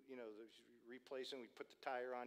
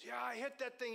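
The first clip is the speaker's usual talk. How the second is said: high, loud outburst; excited stranger; 10 semitones higher, 6 dB louder than usual. Retold or imitated. Stranger to driver about the road debris, high and excited. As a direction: blurt it out high and loud, imitating him. Stranger reporting the same mishap.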